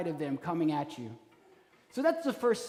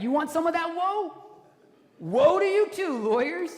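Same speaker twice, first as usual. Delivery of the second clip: much higher, louder jeering woe; mock taunting shout; 10 semitones higher, 7 dB louder than usual. Jesus taunting the lawyers with a loud high woe. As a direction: hurl it back at them, half laughing. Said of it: "He was like".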